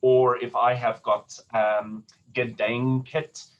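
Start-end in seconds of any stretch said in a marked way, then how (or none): 2.3-3.5 gedenket slowly, stressing DEN; light ge, drawn-out DEN in gedenket; second-syllable stress. Shown as a demonstration. Teacher demonstrates exception for singers, gedenket slowly stressed on second syllable. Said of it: "that's the second stress".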